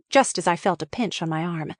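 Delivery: plain reading voice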